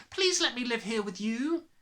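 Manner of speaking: put-on high pleading child's voice; a girl pleading with her teacher; crying out. A narrator's reading. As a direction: beg to stay. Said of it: "Matilda cried out".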